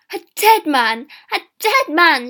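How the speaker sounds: very loud, high taunting cry; angry calling. A narrator's reading. Girl cursing the departing peddler, calling angrily. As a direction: shout it after him like a curse. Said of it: "she called".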